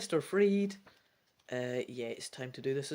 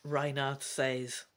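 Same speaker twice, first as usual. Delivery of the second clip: ordinary delivery, nothing marked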